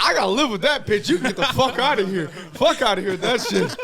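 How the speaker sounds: high, loud, half-laughing shout; hammed-up yelling to get out; exasperated yell. Son choosing mom over one-day girl, exasperated yell.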